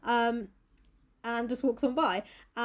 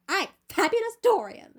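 camp Dorian; 7 semitones higher, 4 dB louder than usual. Retold or imitated.

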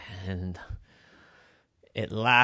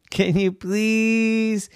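slow plea; 10 semitones higher, 3 dB louder, much slower than usual. Acted. Pig begging.

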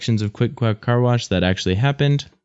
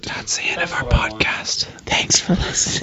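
unvoiced whispered sign-off; whispering; 3 semitones lower than usual. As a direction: whisper the goodbye. Mock podcast sign-off whisper.

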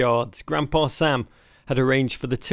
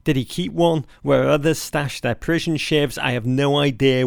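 ordinary talk

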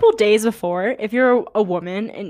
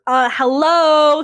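drawn-out, loud sarcastic "hello?"; exasperated, pitched up, stretched slow; 6 semitones higher, 8 dB louder, much slower than usual. Real, not acted. Retorting to astrology doubters, loud sarcastic disbelief.

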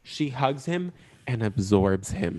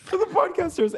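mock high echo.

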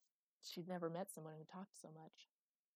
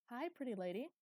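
put-on raised, louder boyish call; 6 semitones higher, 6 dB louder than usual. A narrator's reading. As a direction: call out to a stranger, pitched up. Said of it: "he called out to her".

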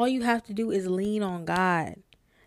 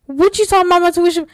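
high, loud shocked outburst; stunned disbelief; 8 semitones higher, 9 dB louder, faster than usual. Retold or imitated. Acting out disbelief at paid tuition.

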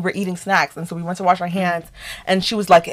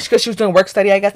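ordinary talk

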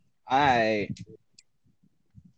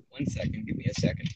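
ordinary delivery

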